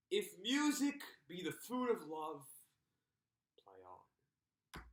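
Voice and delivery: slow poetic recital, falling away at the close; reciting the first line. Shown as a demonstration. Teacher quoting the speech's first line, reciting. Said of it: "And it goes like this".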